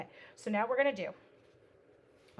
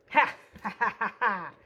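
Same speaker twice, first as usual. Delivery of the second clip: triumphant laugh; 10 dB louder than usual. Real, not acted.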